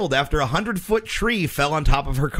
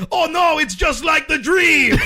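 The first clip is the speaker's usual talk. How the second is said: loud, high, slow panicked yell; panicked shout; 12 semitones higher, 4 dB louder, slower than usual. Acted. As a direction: scream it in mock panic. Joke quote of panicked bomber.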